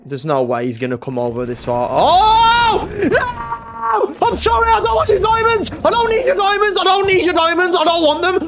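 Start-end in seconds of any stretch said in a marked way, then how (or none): 1.9-8.5 very high-pitched terrified pleading; frantic shrieking apology; panicked screaming. Real, not acted. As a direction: scream and beg for your life. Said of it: "Why am I screaming over eight-bit".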